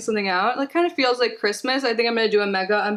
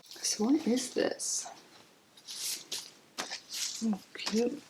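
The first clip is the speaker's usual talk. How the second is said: hushed; 11 dB quieter, slower than usual. Real, not acted. Quiet hushed unboxing.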